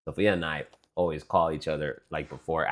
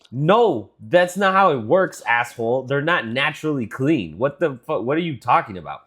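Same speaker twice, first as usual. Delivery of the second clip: high, loud incredulous rant; exasperated; 6 semitones higher, 4 dB louder than usual. Retold or imitated. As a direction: scold her in disbelief. Retelling the argument, her own reply.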